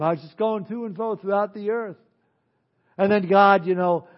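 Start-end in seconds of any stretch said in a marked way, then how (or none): 0.0-2.0 offhand reply, lighter and higher than a devil voice; casual Satan. Acted. Satan telling God where he has been, casual offhand voice. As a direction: play Satan casually shrugging off the question. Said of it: "you can almost picture him".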